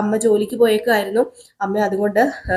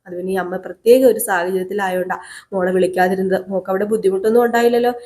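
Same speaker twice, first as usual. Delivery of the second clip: normal talking voice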